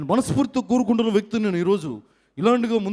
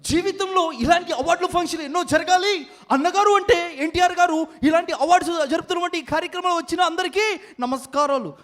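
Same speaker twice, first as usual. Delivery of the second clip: much higher, eager speech voice; high-voiced impression; 9 semitones higher than usual. Mimic salutes the NTR awards in a much higher voice. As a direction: greet everyone in a high piping voice.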